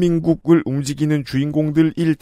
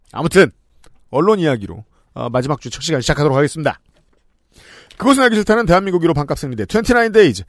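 sponsor read; 5 semitones higher, faster than usual. Acted.

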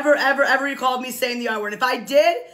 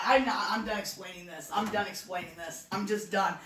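fed up; 5 semitones lower, 9 dB quieter than usual. Real, not acted. Weary, giving up on explaining.

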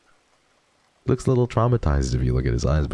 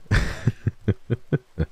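breathy laugh; 6 semitones higher than usual. Real, not acted. Laughing at mom's text.

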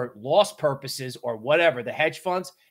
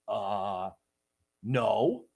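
sheepish denial; 7 semitones lower, 6 dB quieter than usual. Retold or imitated. Caught lying to parents.

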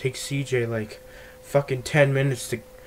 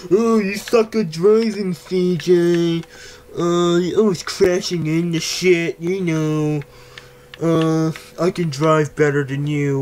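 higher, louder nagging mock voice; mocking Ryder; 4 semitones higher, 5 dB louder than usual. Imitating Ryder's complaints about his driving in a mocking nag. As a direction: whine like a know-it-all passenger. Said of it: "you're always complaining".

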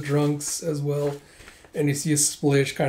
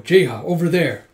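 louder acted call-out; urgent call; 2 semitones higher, 7 dB louder than usual. Yuna sends Jeha towards the fight, urgent call. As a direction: direct an ally across the room.

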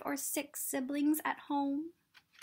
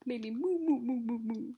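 cow laugh moo. Shown as a demonstration. Reading to kids, imitating a laughing cow's moo. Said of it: "What do you think a cow's laugh would sound like".

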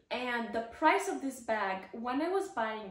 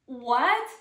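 astonished; 2 semitones higher, 9 dB louder than usual. Retold or imitated. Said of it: "I almost lost it. I was like".

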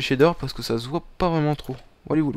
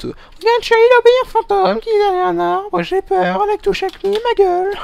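scared wife voice; 4 dB louder than usual. Acted. Scared wife voice about a ghost.

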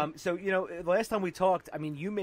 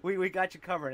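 loud reassurance; 4 semitones higher, 6 dB louder than usual. Retold or imitated. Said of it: "they're like".

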